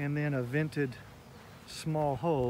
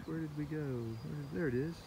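ordinary delivery

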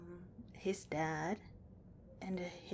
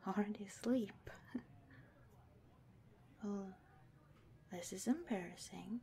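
embarrassed whisper; 8 dB quieter than usual. Acted. Partner fell asleep mid-confession.

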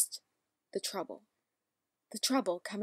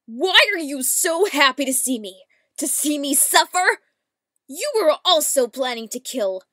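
furious, shouting accusation; angry shout; 5 semitones higher, 13 dB louder than usual. Acted. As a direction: yell it at her in rage. Angry accusing character lines.

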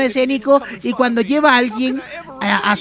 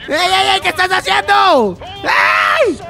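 alarmed; 6 semitones higher, slower than usual. Real, not acted. Pilot swerves, gamer alarmed.

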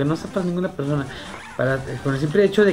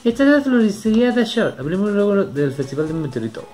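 NPC voice; 9 semitones higher, 3 dB louder than usual. Acted. Voicing NPC florist greeting.

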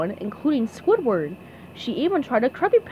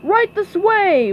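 loud, raised, drawn-out summons; barked call; 9 semitones higher, 7 dB louder, slower than usual. A narrator's reading. Mister Krabs herding customers in, barking loudly. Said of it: "barked Mister Krabs".

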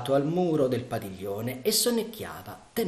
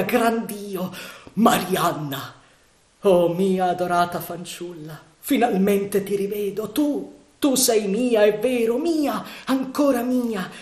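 ecstatic reunion; 8 semitones higher, 7 dB louder than usual. Acted. Sandokan reunited with his beloved.

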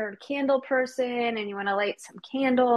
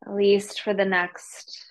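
guided meditation; 3 semitones lower than usual. Real, not acted. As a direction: ease them gently into stillness, unhurried. Leads the class into meditation.

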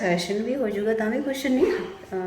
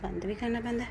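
repeated much softer, half to herself; quiet aside; 11 dB quieter than usual. Shown as a demonstration. Vlogger echoing her own joke to viewers, much softer. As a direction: drop the echo to a near murmur.

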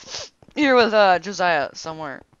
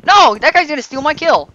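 loud annoyed outburst; frustrated shout; 7 semitones higher, 7 dB louder than usual. Real, not acted. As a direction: yell in gaming frustration. Frustrated gamer yells at rival.

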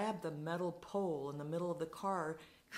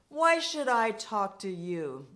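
loud, raised, spitting retort; drunken challenge; 4 semitones higher, 10 dB louder than usual. Retold or imitated. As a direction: throw it in the old man's face. Drunk confronting old man.

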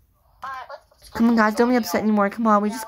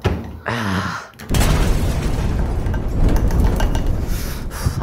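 long weary sigh. Pretend. Tired after working all day.

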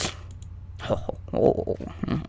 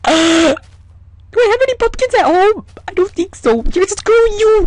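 much higher and eager; excited; 13 semitones higher, 3 dB louder than usual. Real, not acted. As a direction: jump at the idea. Gasping excitement.

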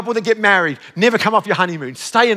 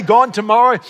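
usual speaking voice

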